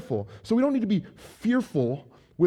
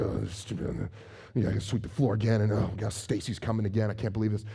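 low, muttered complaint under the breath; grumbling; 5 semitones lower, 5 dB quieter, faster than usual. Retold or imitated. Grumbling to yourself while cleaning for a guest. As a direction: grumble about the chore. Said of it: "And you're angry".

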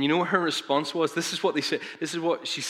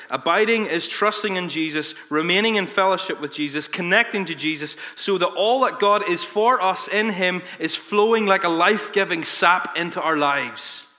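raised, fervent quote reading; quoted reading; 5 semitones higher, 5 dB louder than usual. Acted. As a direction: read Piper's words with rising fervour, slowing at the end. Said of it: "John Piper says this way".

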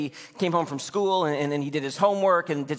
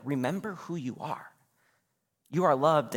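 ordinary talk

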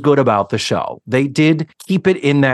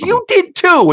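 imitating the wife, high and louder; high-pitched, louder wife comeback; voiced wife insisting; 6 dB louder than usual. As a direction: snap it back, high and insistent. Wife insists back in argument.

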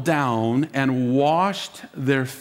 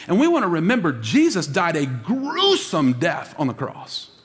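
ordinary talk, nothing marked

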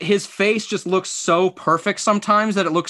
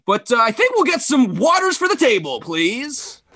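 Kevin Harlan ordering; 8 semitones higher than usual. Pretend. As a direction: order a table like you're calling a game. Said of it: "you're Kevin Harlan".

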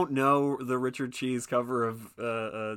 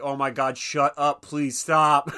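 loud, pleading shut up; exasperated yell; 4 semitones higher, 10 dB louder, slower than usual. Retold or imitated. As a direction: groan it out loudly. Retold annoyed characters.

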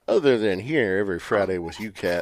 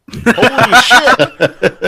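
loud, slow, laughing disbelief; stunned outburst with a laugh; shocked laughing; 14 semitones higher, 16 dB louder, much slower than usual. Real, not acted. Camera finally turned on.

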